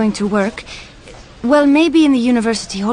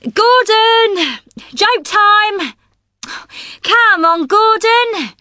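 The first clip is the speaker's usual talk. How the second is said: calling out; 8 semitones higher, much slower than usual. Real, not acted. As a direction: summon him eagerly. Calling Gordon to the mic.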